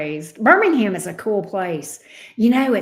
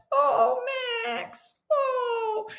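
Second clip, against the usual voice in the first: cooing sympathy; 4 dB quieter, much slower than usual. Retold or imitated. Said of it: "And I'm like".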